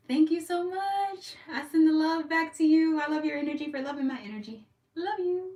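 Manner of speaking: high, loud, affectionate sing-out; gushing thanks. Real, not acted. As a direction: send love out in a lifted voice. Thanking live viewers in sweet voice.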